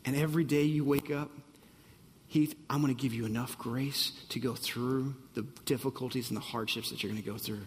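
hushed, tender voice of God, slightly hurried; God's reassuring voice. Acted. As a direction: reassure him quietly as God would. God speaking to Heath.

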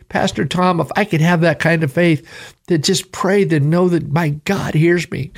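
fervent sermon climax; preaching. Real, not acted. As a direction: lift it into impassioned preaching. Pastor rises into preaching fervor.

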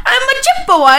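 high, chirpy mock Irish; laughing, badly faked Irish lilt; Irish accent. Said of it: "That was a little Irish".

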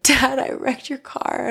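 high, drawn-out wail of confession; mock tearful wail. Daughter confessing to dad about his car, mock wailing. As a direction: wail it slowly, imitating a sobbing teen.

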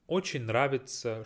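ordinary talk, nothing marked

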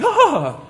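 high, startled movie gasp; dramatic gasp. Shown as a demonstration. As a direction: gasp as if something just happened. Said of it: "like, a movie when something suddenly happens".

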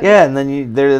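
words pushed out with a burp. Real, not acted. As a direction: talk through a burp. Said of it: "Excuse me. I apologize for the burp".

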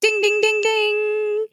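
high chiming ding ding; game show bell. Pretend. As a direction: ring it out like a quiz bell. Game-show winner bell call.

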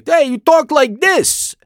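put-on high, slow, broad New Jersey accent. Mimicking what everyone expects from New Jersey, in an exaggerated Jersey accent. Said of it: "everyone thinks if you're from New Jersey".

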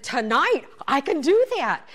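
loud, eager yes to a class tonight; eager loud reply. Retold or imitated. Telling her friend she can make it tonight, eager loud reply.

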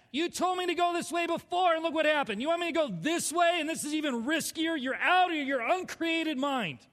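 high, loud re-enacted outrage; mad. Arguing with the Lord about a riskier way, mad. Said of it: "I was mad".

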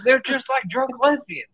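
high, hammed-up imitation of a ranting old angler; mock old fisherman. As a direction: squeeze it up high like a cranky old-timer. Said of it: "He goes".

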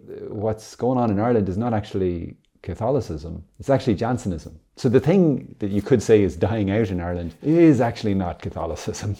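ordinary talk

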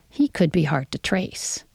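ordinary delivery

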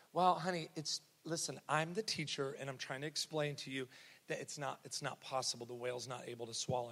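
lightly voiced teacher, low and soft; patient teacher. Teacher explaining to a little girl, mildly patient, hushed. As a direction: quietly explain it, as if to a stubborn child. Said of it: "And the lady goes".

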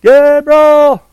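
long, high call from far away; distant calling. Retold or imitated. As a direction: call his name across the snowy woods. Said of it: "a voice".

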